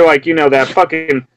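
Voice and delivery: ordinary delivery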